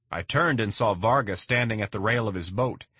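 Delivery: ordinary delivery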